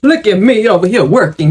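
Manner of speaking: normal talking voice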